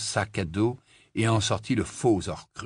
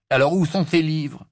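loud, higher, brusque question; impatient demand; 7 semitones higher, 6 dB louder than usual. A narrator's reading. Ron asking Hermione for the books, impatient. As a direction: demand it loudly.

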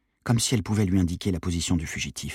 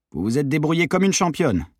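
higher-pitched, coaxing insistence; insistent praise; 8 semitones higher, 4 dB louder than usual. A narrator's reading. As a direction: press her with a compliment. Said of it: "insista mon père". (compliment insistant)

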